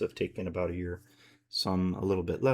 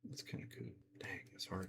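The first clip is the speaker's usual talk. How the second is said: whispering; 17 dB quieter, faster than usual. Real, not acted. Muttering to himself while painting.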